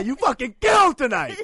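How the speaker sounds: loud, high, slow cheer; excited shout. Retold or imitated. Friend quoting a text after a comedy set, shouted. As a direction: cheer it like a proud fan. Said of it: "I have to say".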